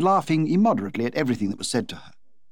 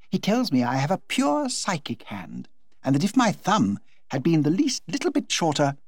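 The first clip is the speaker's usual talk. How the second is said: a higher, breezy, delighted hostess voice; Lady Windermere chatters happily to the Duchess; gushing society hostess; 7 semitones higher than usual. Acted.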